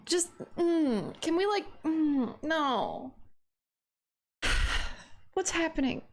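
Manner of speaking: ordinary talk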